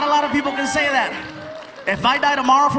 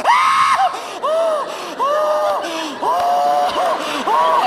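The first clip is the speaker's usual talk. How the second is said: very high, loud panicked scream; terrified shriek; 5 dB louder, much slower than usual. Pretend. Pedestrian nearly run over, loud panicked shriek. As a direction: scream as a car nearly hits you.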